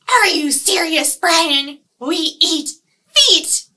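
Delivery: loud, high snarling fish voice; angry piranha. Acted. Piranha scolding fruit-loving Brian, loud snarl. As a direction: snap at Brian like a hungry fish. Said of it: "my piranhas here".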